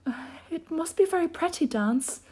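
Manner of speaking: put-on high, hesitant girl voice; shy high-voiced girl; timid Alice. A narrator's reading. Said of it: "said Alice timidly".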